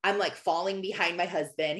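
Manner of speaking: usual speaking voice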